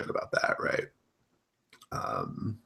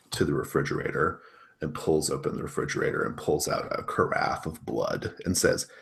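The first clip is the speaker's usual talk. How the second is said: ordinary talk, nothing marked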